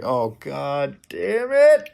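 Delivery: slow, dragged-out exasperation; exasperated groan. Real, not acted. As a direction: drag it out, fed up. Reacting to fanfic content.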